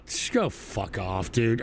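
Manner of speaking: muttered disgust. Real, not acted. Disgusted at a video.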